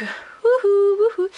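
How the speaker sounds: high, loud ironic whoop; mock cheer. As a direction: cheer sarcastically at the mess. Ironic cheer over clutter. (youhou ironique, aigu et fort)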